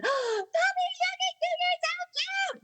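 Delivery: put-on high squealing baby talk, slowing on the cute; overexcited dog greeting. Shown as a demonstration. Showing how not to greet a dog, squealing high and excited. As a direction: gush over the dog like it's a baby. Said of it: "if you come in".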